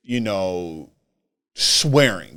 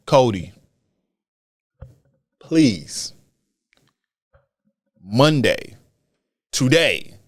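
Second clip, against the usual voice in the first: measured plea, each word set apart; pleading; slower than usual. Real, not acted. Podcaster begging Cody before Monday's show, pleading. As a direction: plead with long pauses between words. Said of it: "I'm pleading".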